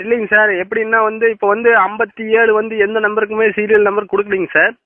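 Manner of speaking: usual speaking voice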